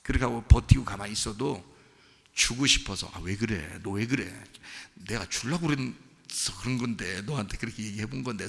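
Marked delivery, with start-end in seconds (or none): 3.1-8.5 low, softened pleading voice; gentle remonstrance. Acted. God asking a stubborn child why, low and placating. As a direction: reason softly with a sulker, voice dropped low.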